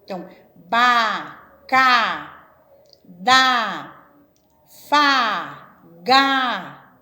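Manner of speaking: loud, slow, separate syllables "ba", "ca", "da", "fa", "ga"; teacher sounding out "ba", "ca", "da", "fa", "ga" one by one, loud and slow; syllable drill. Shown as a demonstration. Teacher drilling syllables "ba", "ca", "da" for a class, loud and separate. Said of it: "trabalha-se só com uma vogal".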